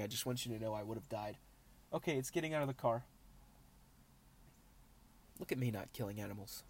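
usual speaking voice

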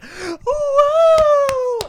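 high, loud whooping woo; mock cheer. Mocking conference-goers who cheer, a loud high woo. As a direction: ham up an obnoxious fan's cheer. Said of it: "they're always like".

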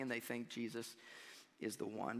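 preacher hushing before the betrayal; dropped low. Real, not acted. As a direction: drop the voice to a hush. Ominous lead-in to betrayal.

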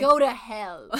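mock hostile.

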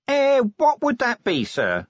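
very high, loud, squeaky query; evasive Nobby. Acted. Nobby dodges his commander's question, high and evasive. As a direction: play dumb in a high-pitched voice. Nobby plays dumb to Vimes.

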